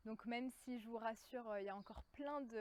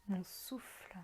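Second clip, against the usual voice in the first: half-whispered calm, drawing out each phrase; guided meditation; 2 semitones lower, 7 dB louder than usual. Acted. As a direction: guide them gently into their breathing, unhurried. Leads a guided breathing meditation.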